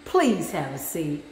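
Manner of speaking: condescending sass. Retold or imitated. Telling a hater to sit down, low and mock-sweet. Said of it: "I be like".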